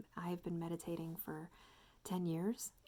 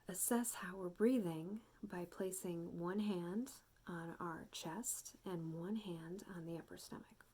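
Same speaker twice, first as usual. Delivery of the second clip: ordinary delivery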